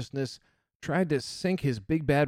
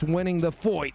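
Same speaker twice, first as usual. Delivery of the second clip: normal talking voice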